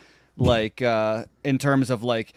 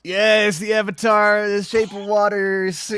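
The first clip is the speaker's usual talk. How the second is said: higher, slower grand announcement; hyped intro; 6 semitones higher, slower than usual. Acted. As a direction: announce it like a big entrance. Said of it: "I'll get it right this time".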